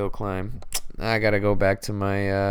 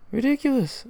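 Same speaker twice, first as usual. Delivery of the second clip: Ridiculous squeaked much higher, falling at the end; squeaky disbelief; 3 dB louder than usual. Real, not acted. Fan repeating his reaction to the loss, squeaky Ridiculous. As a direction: shriek Ridiculous in exasperated disbelief.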